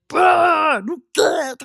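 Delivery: high, drawn-out cry; acted-out martyr's shout; shouted proclamation. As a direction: cry out high, holding the vowels. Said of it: "하고 소리친 거예요".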